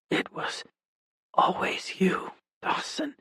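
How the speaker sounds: faint, strained whisper; each word costs her strength; weak whisper. Acted. Dying London whispers to Dawson, faint. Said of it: "Every word seemed to take all her strength".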